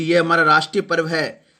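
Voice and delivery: usual speaking voice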